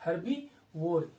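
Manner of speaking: slow teacher's repeat of herbivore; slow, drawn-out repeat. Shown as a demonstration. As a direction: stretch herbivore out slowly, syllable by syllable. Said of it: "वो कैसा हो गया?".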